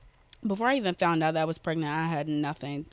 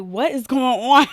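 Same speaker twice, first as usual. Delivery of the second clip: higher, louder shocked question; surprised; 6 semitones higher, 11 dB louder than usual. Retold or imitated. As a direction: exclaim it in sudden alarm. Retold shock at late period.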